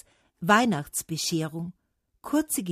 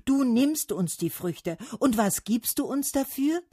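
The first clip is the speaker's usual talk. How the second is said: higher-pitched reproachful demand; calling out; 7 semitones higher, 3 dB louder than usual. A narrator's reading. Trees demanding something from Autumn, calling out. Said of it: "riefen sie da".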